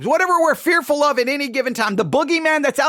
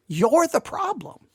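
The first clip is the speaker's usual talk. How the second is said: normal talking voice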